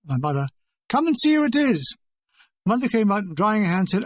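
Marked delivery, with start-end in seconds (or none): 0.9-1.9 high call to someone in another room. A narrator's reading. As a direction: call her over, pitched well up. Father calling his wife over.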